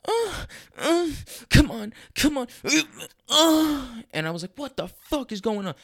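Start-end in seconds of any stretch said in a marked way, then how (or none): 0.1-1.1 high, very loud workout grunts; loud mock grunts. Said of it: "I'm gonna get loud here". 1.5-2.5 high, forceful gym self-urging; loud straining imitation. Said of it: "I'm gonna get loud here". 2.6-4.0 long high straining groan, very loud; loud mock grunts. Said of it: "it kept getting louder". 4.6-5.7 high-pitched, baffled outburst; retold bewilderment. Acted shock at loud gym grunts.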